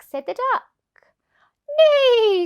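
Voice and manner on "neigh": loud, high whinny; horse neigh. Acted. Horse answering the ladybird with a loud neigh. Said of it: "said the horse".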